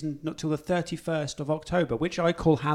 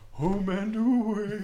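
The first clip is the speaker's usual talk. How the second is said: slow, higher, eerie spooky voice; 6 semitones higher, much slower than usual. Shown as a demonstration. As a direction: drag it out like a ghost. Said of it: "I could put, like, spooky voices on it".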